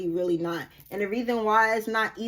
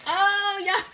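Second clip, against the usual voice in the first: much higher, near-shouting joy; overjoyed squeal; 3 dB louder than usual. Real, not acted. Reader thrilled by the cards' energy, high excited squeal. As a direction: squeal with delight. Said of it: "I love this energy".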